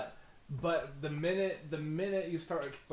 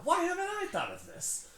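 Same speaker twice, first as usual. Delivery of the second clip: much higher, squealing, stressing why; squealing disbelief; 4 dB louder than usual. Acted. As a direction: shriek it in envious disbelief. Tony repeats in amazement.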